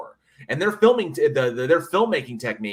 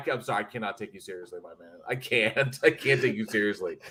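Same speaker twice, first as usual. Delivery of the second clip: deadpan apology; 4 semitones lower, 5 dB quieter than usual. Retold or imitated. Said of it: "I'm like".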